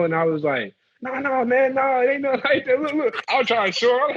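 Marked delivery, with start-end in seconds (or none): 1.0-3.2 raised, urgent pleading; high pleading. Retold or imitated. Tourist insists to the guard it ain't like that, high urgent pleading. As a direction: talk fast to calm him down.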